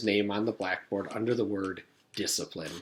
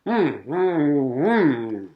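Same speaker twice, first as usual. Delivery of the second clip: taped-mouth mm sounds, louder; muffled hum; 4 semitones higher, 6 dB louder, much slower than usual. A narrator's reading. Jason answering through taped mouth, muffled hum. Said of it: "Jason said back to them".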